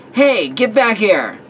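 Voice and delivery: high, slow, drawn-out call after someone; shout. A narrator's reading. Chasing a friend who ran off, playful shout. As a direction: shout it loudly at someone running off. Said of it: "I shouted, chasing her".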